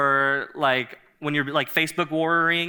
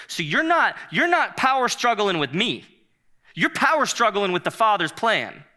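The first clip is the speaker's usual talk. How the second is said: emphatic rebuke; 6 semitones higher, 4 dB louder than usual. Acted. Pastor voicing Moses' rebuke.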